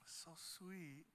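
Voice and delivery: very soft, acted aside; hushed tender reaction. Man watching a little girl apologize, hushed tender reaction. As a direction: murmur it, touched by the sweetness.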